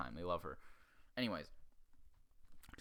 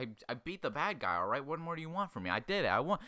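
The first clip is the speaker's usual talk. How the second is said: higher, louder retold outburst; mock indignant protest; 5 semitones higher, 4 dB louder than usual. Storyteller as pupil arguing he beat the bad guy, mock indignant. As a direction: protest your win, rising in pitch and volume.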